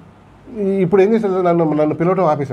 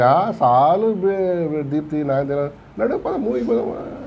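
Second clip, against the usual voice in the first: imitating old friend; much slower than usual. Old friend tempting someone out to a movie, imitated coaxing. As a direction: coax like an old pal, stretching the words. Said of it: "పాత దోస్తులకి ఏంటి".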